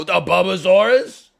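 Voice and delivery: put-on dad excitedly echoing the kid's dinosaur name, "A Bubbasaurus?" loud with a high rise; amazed echo. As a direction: echo "A Bubbasaurus?" as if it's an astonishing discovery. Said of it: "And I go".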